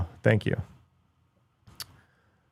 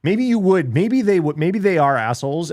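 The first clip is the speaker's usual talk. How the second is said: heated; 7 semitones higher, 4 dB louder than usual. Real, not acted. Heated rant about online manners.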